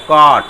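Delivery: कॉट said with open short aw sound. Shown as a demonstration. Teacher showing the right reading: कॉट with open aw. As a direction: model कॉट clearly for the class. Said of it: "ऐसे मत पढ़ना कोट".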